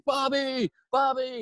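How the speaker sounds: high, loud friendly call across the room. Retold or imitated. Party guests cheerfully calling out to Bob. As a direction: hail him like an old buddy.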